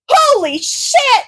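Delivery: sudden high, loud and slow yell of shock. A narrator's reading. Izuku realizes who the hero is, yelling in shock. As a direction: blurt it out in shocked disbelief. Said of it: "Izuku yells".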